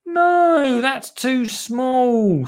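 very high, drawn-out objection; Lottie's child voice. Acted. Lottie rejects Dottie's pot, high drawn-out child voice. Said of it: "said Lottie".

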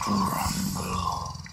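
low, drawn-out grumble; rumbling tummy noise. A narrator's reading. Narrator imitates the mysterious rumbling, low drawn-out growl. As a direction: stretch the word into a rumble.

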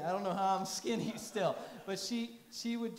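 amused laugh mid-line. Real, not acted. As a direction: laugh at yourself. Laughing at own story.

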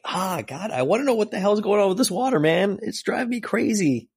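high, fast, exasperated rant; can't stand not knowing what the water is; exasperated outburst. Real, not acted. Reader fed up with the comic's mystery, ranting high and fast.

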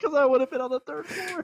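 high laughing words. Real, not acted. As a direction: laugh through the line. Laughing at own mistake.

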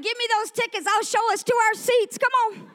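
high-pitched re-enacted glee; triumphant. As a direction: take charge with excited swagger. Acts out triumph at upgrade.

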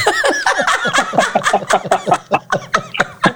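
real burst of laughter, climbing high; long high laugh. Real, not acted. Cracking up at the rapper who ran away, laughing high. Laughing at his own story.

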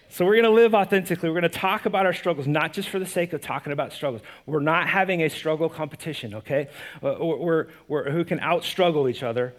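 ordinary talk, nothing marked

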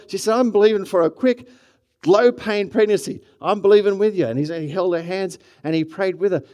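loud, high-pitched preacher delivery; full-force preaching. Real, not acted. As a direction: preach it out to the whole room.

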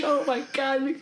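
high panicked gasp. Retold or imitated. Panicked reaction in story.

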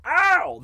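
high, loud "ow"; mock pained yelp. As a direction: yelp in exaggerated pain. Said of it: "to make somebody go".

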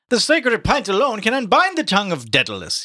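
high theatrical declamation; mock-solemn proclamation. A narrator's reading. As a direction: intone it high like a mock priest. Mulligan proclaims with raised hands.

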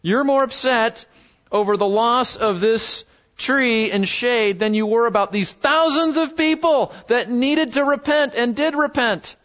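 high, emphatic rebuking question; pointed divine reproof, pitched high; God questioning Jonah. Acted. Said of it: "and God says".

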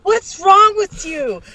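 high, slow, shrill rebuke; high outraged yell. Retold or imitated. Wife scolding husband on video, high slow shriek. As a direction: scream it down at him. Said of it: "I sound fucking".

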